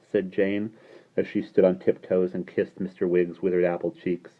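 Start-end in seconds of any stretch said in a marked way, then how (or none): none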